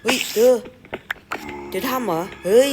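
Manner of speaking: loud, high excited yell at finding a cave; high, loud burst of real excitement; excited shout. Real, not acted. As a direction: shout it out, thrilled by the find. Finds a cave in game.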